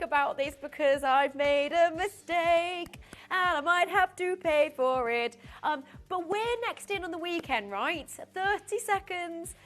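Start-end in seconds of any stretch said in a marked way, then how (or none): none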